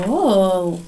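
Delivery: normal talking voice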